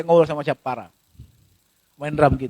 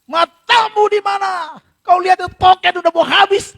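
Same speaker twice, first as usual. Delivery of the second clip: angry scolding; 4 semitones higher than usual. Acted. Spouse quarrelling over the empty electricity token, angry scolding. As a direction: lay into your spouse, stretching the words. Acted husband-and-wife quarrel.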